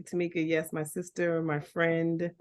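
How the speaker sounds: ordinary delivery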